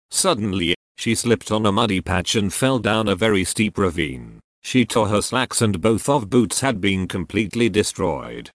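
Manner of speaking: ordinary delivery, nothing marked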